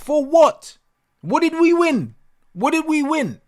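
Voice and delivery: high-pitched, incredulous demand; exasperated rhetorical questions; indignant outburst. Real, not acted. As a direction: demand an answer, pitch right up. Indignant questions about a hollow win.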